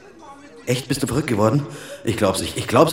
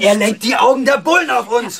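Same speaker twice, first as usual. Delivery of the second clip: higher, louder angry yelling at his mother; angry shouting; 13 semitones higher, 4 dB louder than usual. Real, not acted. Son yelling at his mother over little brother, furious shout. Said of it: "Hör auf zu schreien".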